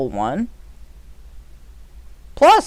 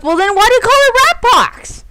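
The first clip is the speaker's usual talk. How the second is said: mocking high voice; 11 semitones higher, 3 dB louder than usual. Mocking quote of officials.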